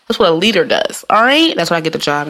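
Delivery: ordinary talk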